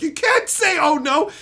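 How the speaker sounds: laughing, disbelieving shout at the TV; yelling. Retold or imitated. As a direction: yell at her that it makes no sense. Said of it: "I started yelling at her".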